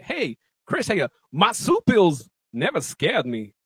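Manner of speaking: lightly voiced Walken, high on hey, slow after; a hint of Walken's odd slow phrasing; lightly imitated Walken, slow and halting; Christopher Walken. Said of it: "Kevin Pollak's impression of Christopher Walken".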